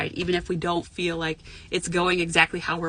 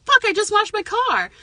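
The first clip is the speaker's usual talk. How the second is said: high, loud mock outrage; acted-out exasperated outburst, pitched way up; negative reaction; 6 dB louder than usual. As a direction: complain like it ruined your day. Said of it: "some people might go to the negative".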